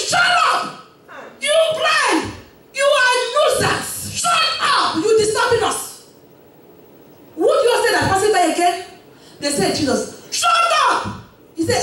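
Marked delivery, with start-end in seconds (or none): 0.1-5.8 much higher, angry scolding; crowd rebuking. Acted. Crowd rebukes blind man. 9.8-11.1 crowd rebuking. Acted. Crowd rebukes blind man.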